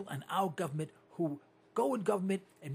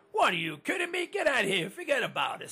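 higher New York brush-off to the wife; New York accent; 6 semitones higher, 3 dB louder than usual. Retold or imitated. Husband answering his wife's nagging, New York accent. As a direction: shrug her off New York style. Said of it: "I go".

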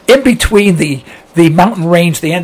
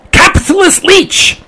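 high-pitched screaming duck; hammed-up, high cry; mock scream; 13 semitones higher than usual. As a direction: screech it as an accusing duck. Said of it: "The duck screamed".

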